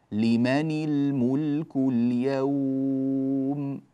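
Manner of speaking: لمن الملك اليوم slowly recited, every ل sounded; slow Quranic model, long end on اليوم; clear lam recitation. Shown as a demonstration. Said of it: "هكذا اللامات ظاهرة هنا".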